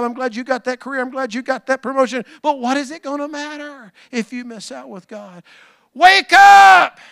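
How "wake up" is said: high, loud, sharp Wake up!; commanding shout. Acted. Pastor shouts the Greek command at the congregation, very loud. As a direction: bark it like an alarm going off. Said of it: "It's a command".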